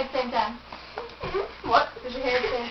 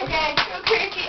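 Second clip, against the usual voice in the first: ordinary talk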